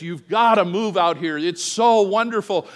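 high, loud and gushing; excited. Retold or imitated. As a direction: sell it with big enthusiasm. Sister's enthusiastic letter quoted.

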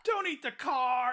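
high, loud, slow exasperated yell; mock-frantic movie-star yell at a dog; Tom Hanks yelling at dog. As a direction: yell at a dog wrecking your car. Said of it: "the voice from Turner and Hooch".

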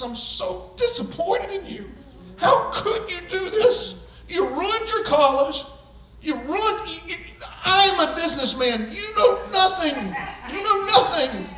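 hammed-up disappointed dad; mock tearful scolding. Dad's last words to his son, half-crying scold.

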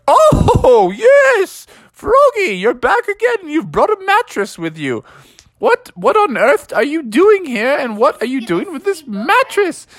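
high, fluttery owl voice; old owl. Acted. Alfred the owl puzzled to see Froggy back, high fluttery voice.

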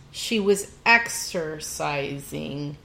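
slow, drawn-out words for emphasis; pointed repeat. Real, not acted. Cohost driving home a friend's admission, slow and pointed. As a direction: spell it out slowly, word by word.

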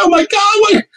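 very high, hammed-up alarm; mock panic. Roommate in the middle of a ghost scare, mock panic.

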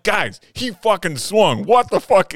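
slow, high, loud outraged yell; furious shout. Retold or imitated. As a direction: shout at the dugout, fully fed up. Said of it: "I am losing my shit on the mound".

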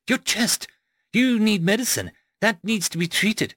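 high-pitched, urgent concern; alarmed exclamation. A narrator's reading. Rose sees the narrator's injured chest, alarmed exclamation. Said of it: "she exclaimed".

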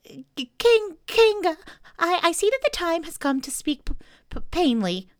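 high, stammering little Piglet voice; trying to sound brave. A narrator's reading. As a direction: stammer loudly, summoning all your courage. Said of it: "in as brave a voice as he could".

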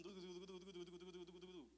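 rapid soft heartbeat noise; imitated racing heartbeat. Nervous son called over by his parents, imitated racing heartbeat. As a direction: patter it out like a pounding heart. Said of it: "aquí el corazón".

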